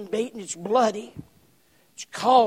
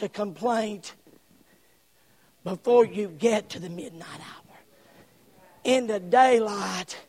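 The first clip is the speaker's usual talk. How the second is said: solemn preacher's warning; 3 semitones lower, slower than usual. Real, not acted. Preacher warning the congregation about complaining, solemn and hushed. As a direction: bring it right down, slow and weighty.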